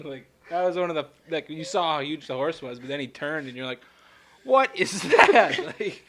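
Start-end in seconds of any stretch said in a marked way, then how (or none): 4.4-5.6 high, slow, loud stunned question. Retold or imitated. Seeing the horse's size, stunned, slow and loud. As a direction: gape at it slowly, loud and high.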